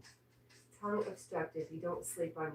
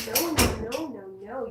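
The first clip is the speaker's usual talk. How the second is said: loud, higher no no no; loud scolding; 6 semitones higher, 11 dB louder than usual. Real, not acted. Owner stopping dog Jack going in there, loud. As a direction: shout the dog back.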